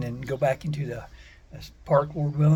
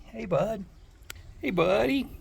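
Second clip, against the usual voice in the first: pet talk; 9 semitones higher than usual. Real, not acted. Greeting the husky at his side.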